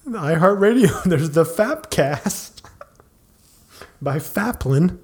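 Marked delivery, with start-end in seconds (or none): none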